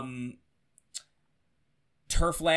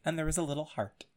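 whisper; 8 dB quieter, faster than usual. Real, not acted. Aside about the note.

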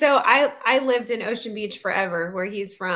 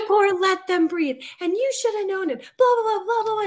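higher and louder, trailing into blah blah; mock angry rant; 10 semitones higher, 4 dB louder than usual. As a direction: parody her tirade, running off into blah blah. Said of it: "but she was so angry".

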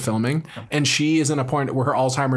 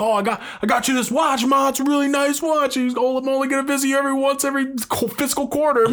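much higher, quick glib salesman voice; slick sleazy son; 12 semitones higher than usual. Retold or imitated. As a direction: play the smug, shallow son. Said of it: "a fucking slick back hair guy".

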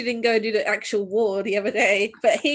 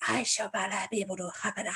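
evil whisper voice; 3 semitones lower, 6 dB quieter, faster than usual. Retold or imitated. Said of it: "speaking the evil whisper voice".

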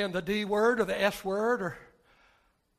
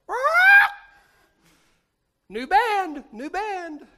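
squawking parrot; high squawky bird voice; 12 semitones higher, 13 dB louder, slower than usual. Acted. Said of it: "And the parrot goes".

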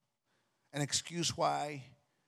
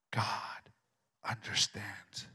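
whisper into your ear; 5 semitones lower than usual. Acted. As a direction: breathe it like a tempter. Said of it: "the enemy will whisper into your ear".

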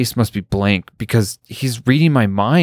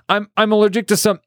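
flustered Heather; 13 semitones higher, faster than usual. A narrator's reading. Heather blurting an allergy.

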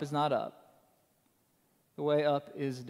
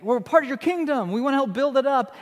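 eager disciples; 7 semitones higher, 5 dB louder, faster than usual. Retold or imitated. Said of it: "they, they like".

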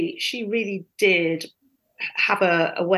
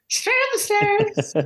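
high, drawn-out mock direction-giving; calling out directions; 12 semitones higher, slower than usual. Clueless new crew member directing guests upstairs, high call. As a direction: send them off in a high, slow sing-out.

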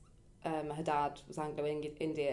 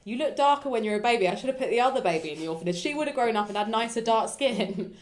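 louder, imitated mum's mock complaint; blunt teasing; 5 semitones higher, 7 dB louder than usual. Mum joking to her daughter about adoption, blunt mock complaint. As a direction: play a blunt mum ribbing her child, projected.

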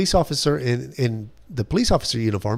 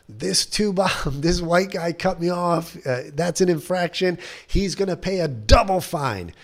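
mock yelling; 8 semitones higher than usual. Officer accuses a driver before the lobby, mock yelling. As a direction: imitate an officer yelling, voice pitched up. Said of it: "he starts yelling".

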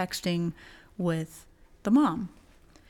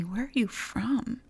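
whispered question; 4 semitones higher than usual. Retold or imitated. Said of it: "she asks me".

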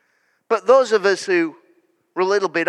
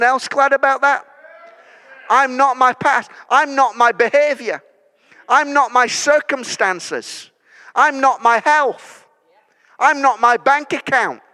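higher, louder declarations piled one on another; preaching at full force; 6 semitones higher, 4 dB louder than usual. Acted. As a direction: proclaim each line like a rallying cry.

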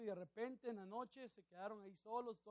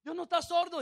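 lightly voiced boy, pitched higher; other child protesting; 7 semitones higher, 5 dB louder than usual. One boy to his shouting brother at prayer, lightly voiced protest. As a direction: object to the yelling in a higher voice. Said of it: "Y el otro niño viene".

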